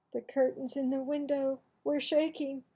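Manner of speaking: usual speaking voice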